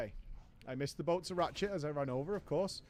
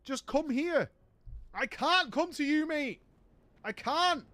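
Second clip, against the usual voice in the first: higher, louder desperate mate; whiny Raven pleading; 5 semitones higher, 4 dB louder than usual. Retold or imitated. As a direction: beg for the money like a needy bloke.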